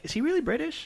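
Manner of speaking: can he really be British; incredulous. Retold or imitated. Puzzling over the actor's accent, high and incredulous. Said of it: "I was like".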